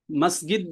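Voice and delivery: first মসজিদ as "masgid", with hard g; Egyptian pronunciation. Shown as a demonstration. Teacher explaining he understands the dialect word, মসজিদ said "masgid". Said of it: "মসজিদ বললে আমি বুঝতে পারছি".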